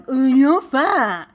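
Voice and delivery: slow, lightly mocking voice of a rude person; mocking idiot. Mocking the rude commenter, slow and lightly mocking. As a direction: sneer it lazily like a rude idiot. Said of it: "idiots who are like".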